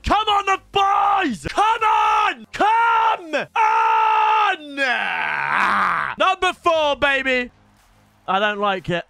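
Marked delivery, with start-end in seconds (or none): 0.0-7.5 high, loud cheering yell; hype shout. Real, not acted. Hyping pack four.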